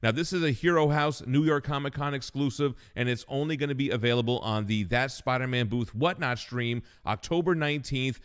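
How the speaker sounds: loud upbeat promo read; punchy announcer pitch. Acted. Reads a comic ad spot.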